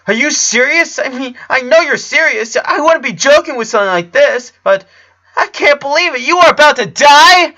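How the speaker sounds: put-on high, loud boyish voice in disbelief; a young friend reeling from terrible news; high-pitched stunned kid; shocked Clyde. A narrator's reading.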